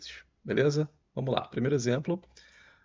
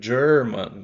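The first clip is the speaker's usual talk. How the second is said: "German" in American English, slow, with a curled R; American-accent German; 8 dB louder than usual. Shown as a demonstration. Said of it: "com o R enrolado".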